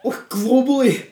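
high, loud, slow words squeezed out through laughter; can barely get it out for laughing. Real, not acted. Mocking Cage's accent while cracking up.

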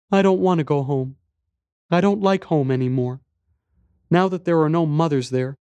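put-on high, small child voice; little boy. A narrator's reading. Boy tells stranger about lost mother, little boy's voice. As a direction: speak as a young boy, pitched high. Boy explains staying away from home.

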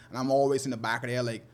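usual speaking voice

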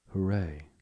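cried hooray. A narrator's reading. Rat has found something in the snow, excited cheer. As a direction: let out a delighted hooray. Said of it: "the rat cried".

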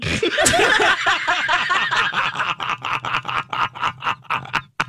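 high, extended belly laugh; long, high real laughter. Real, not acted. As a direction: lose it laughing at the punchline. Laughing at the best retort.

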